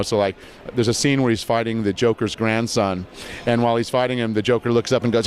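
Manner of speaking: normal talking voice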